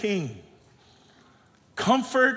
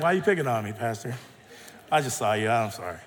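low, dropping quieter to an apology; mock-meek mumbled protest, much lower; picked-on kid imitation; 10 semitones lower, 4 dB quieter, much faster than usual. Pastor imitates Braden protesting being picked on, low and meek. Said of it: "Braden's like".

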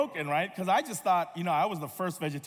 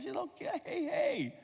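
put-on very high, softer child voice; delighted daughter; 13 semitones higher, 4 dB quieter than usual. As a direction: imitate a little girl adoring a cartoon character. Imitating daughter loving film chicken.

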